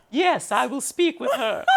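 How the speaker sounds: grand and gracious, granting the interview. Retold or imitated. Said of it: "She goes".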